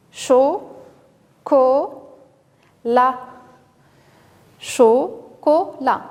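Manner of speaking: "chocolat" slow, cho, co, la, each apart; syllable by syllable. Shown as a demonstration. Teacher asks children where O is heard, syllable by syllable. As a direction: separate "chocolat" into three evenly spaced beats. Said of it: "on a trois syllabes".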